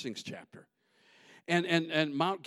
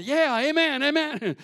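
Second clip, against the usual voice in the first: loud, higher crowd shouting amen; 8 semitones higher, 6 dB louder than usual. Retold or imitated. Preacher voicing the crowd calling back amen. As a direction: cheer it like the whole crowd answering. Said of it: "they're all going".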